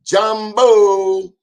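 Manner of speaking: high, loud, beaming Jambo; enthusiastic greeting. Retold or imitated. Host greeting East African viewers, high and loud with enthusiasm. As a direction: throw out Jambo with big enthusiasm. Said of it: "they said it with enthusiasm".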